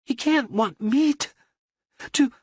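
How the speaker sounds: a cornered wife begging in panic; frightened woman. A narrator's reading.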